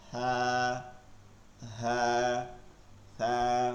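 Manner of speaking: "হ্যাঁ" as a soft "tha", tongue tip at the teeth; low, held "tha" with no hiss; soft, noiseless lisp. Shown as a demonstration. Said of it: "নরম আওয়াজে". (নরম আওয়াজে "সা", শিস ছাড়া)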